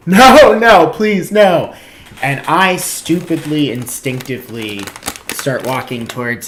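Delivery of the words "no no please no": loud, high hammed-up begging; mock crying plea. Caught shoplifter trying to leave as cops are called, mock pleading. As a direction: imitate a desperate, wailing plea. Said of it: "he's crying, and he's pleading".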